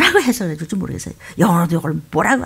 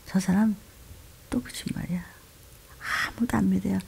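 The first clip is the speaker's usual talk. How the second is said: hushed whisper behind someone's back; gossiping whisper; 11 dB quieter than usual. Retold or imitated. Neighbours gossiping about liar. (뒤에서 수군대듯 속삭이며)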